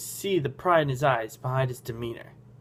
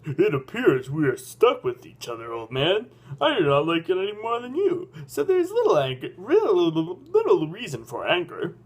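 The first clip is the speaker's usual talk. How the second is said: put-on character voice with swooping pitch; Pancakeus; 3 semitones higher than usual. Young guide conceding he's stuck with the old man, in character. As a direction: sound stiffly serious, tune climbing and falling. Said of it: "That's my Pancakeus voice".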